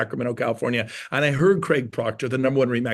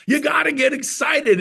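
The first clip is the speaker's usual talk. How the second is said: excited; 11 semitones higher, 4 dB louder than usual. Real, not acted.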